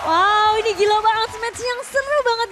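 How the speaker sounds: loud, high-pitched wow; amazed. Real, not acted. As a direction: burst out in awe. Co-caster amazed after the match. (kagum dan heboh)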